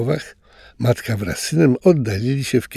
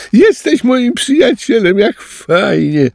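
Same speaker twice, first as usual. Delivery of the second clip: joyful squeal; 12 semitones higher, 5 dB louder than usual. A narrator's reading. Tapir Ciro squeals happily to his new friend. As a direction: squeal it with delight. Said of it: "kwiczał już nie ze strachu, ale z radości Ciro".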